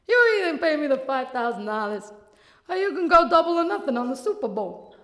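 a hint of a slick bookie; lightly voiced bookie; bookie Tony. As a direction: friendly menace. Tony offer.

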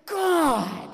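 high, drawn-out cry of despair; exasperated exclamation. Retold or imitated. Father hears daughter's excuse, exasperated cry. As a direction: wail it in mock agony. Dad's despairing reaction, acted.

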